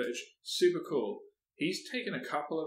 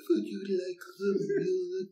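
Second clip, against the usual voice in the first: high, slow spacey drawl; a slow, high space-computer voice; Doctor Space voice; 7 semitones higher, slower than usual. Pretend. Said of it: "should I do, like, Doctor Space".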